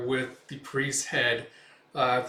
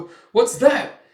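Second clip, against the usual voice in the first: high, loud, slow fearful question; startled stammer; 11 semitones higher, 11 dB louder, slower than usual. Retold or imitated. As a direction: stammer in alarm at a noise. Startled by rustling.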